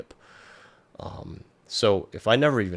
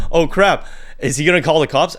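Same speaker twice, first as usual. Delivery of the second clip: mock scared partygoers; 8 semitones higher, 8 dB louder than usual.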